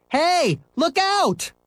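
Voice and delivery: high urgent yell; yelled warning. Acted. Scooter warns Henry of the car, high yell. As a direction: shout a warning at him. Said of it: "Scooter yelled".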